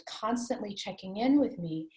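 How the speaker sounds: ordinary talk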